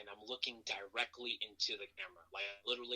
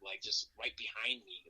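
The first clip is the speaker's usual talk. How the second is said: normal talking voice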